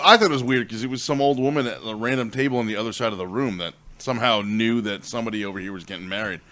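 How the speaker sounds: normal talking voice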